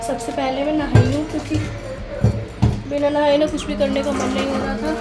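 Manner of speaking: ordinary talk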